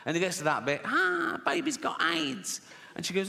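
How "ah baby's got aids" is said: very high, stretched-out jeer; gleeful mock crowing. Comic replaying his own bit onstage, gleeful high mock crowing. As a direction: crow the punchline high, hammed up. Acts himself delivering the bit.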